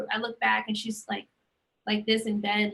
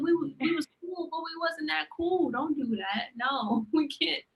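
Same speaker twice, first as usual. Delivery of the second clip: high, stammering, half-laughing refusal; telling her dead uncle's ghost to stop, high and pleading; 6 semitones higher than usual. Retold or imitated. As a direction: beg him to back off, comically. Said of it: "I was like".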